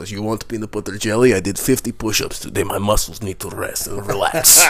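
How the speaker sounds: put-on easygoing Polish accent. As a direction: play a laid-back Polish bunkmate. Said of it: "he was really cool Polish".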